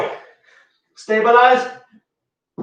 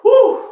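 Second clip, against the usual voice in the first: high, loud whoop of energy; excited whoop; 13 semitones higher, 5 dB louder than usual. Real, not acted. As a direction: let out a big hype whoop. Set finished, celebrating.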